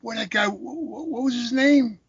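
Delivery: high, stammering puzzled recall; puzzled moviegoers. Retold or imitated. People leaving a movie trying to recall an actor, puzzled. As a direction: imitate someone groping for a forgotten name, pitch climbing. Acting moviegoers groping for a name.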